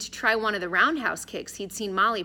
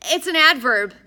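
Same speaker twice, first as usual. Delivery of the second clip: high, loud, eager correction; raised, insistent know-it-all voice; helpful pedant; 8 semitones higher, 11 dB louder than usual. A narrator's reading. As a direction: chime in brightly with the grammar lesson. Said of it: "Addison added helpfully".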